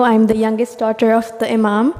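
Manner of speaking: ordinary talk, nothing marked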